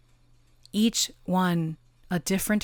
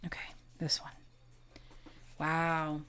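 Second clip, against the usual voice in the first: soft breathy whisper, much softer; 12 dB quieter than usual. Real, not acted. Reader whispers to herself while drawing a card. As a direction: whisper it as you pick the card.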